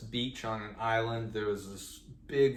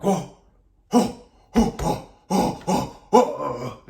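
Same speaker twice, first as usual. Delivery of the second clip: loud, high chimp hooting; aggressive monkey hoots; 5 semitones higher, 11 dB louder, much slower than usual. Retold or imitated. Monkeys come at him for his bananas, aggressive hooting. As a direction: imitate chimps closing in with forceful, spaced hoots. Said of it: "They got more aggressive".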